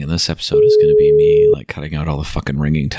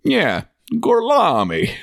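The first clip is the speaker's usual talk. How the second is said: slow, higher, hammed-up fake Italian accent; drawn-out mock Italian accent, higher; 12 semitones higher, slower than usual. Retelling the Inglourious Basterds scene, slow mock Italian accent. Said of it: "he pretends to be Italian and he's like".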